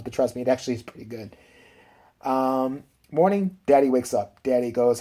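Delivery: ordinary talk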